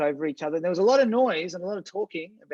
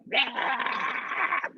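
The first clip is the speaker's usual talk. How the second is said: high, rough imitation screech; mock scream; 9 semitones higher, 3 dB quieter than usual. Teacher imitating a couple fighting in their yard, mock scream. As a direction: imitate a screaming couple with one wordless burst. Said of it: "like screaming, like".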